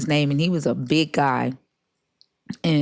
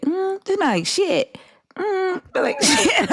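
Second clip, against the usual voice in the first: put-on toddler voice. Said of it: "Blessing kept saying".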